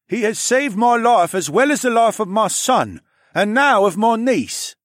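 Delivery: pointing out. Acted. Captain Tharfin defending Alex to his miners, loud and firm. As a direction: defend the ambassador firmly. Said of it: "Tharfin pointed out".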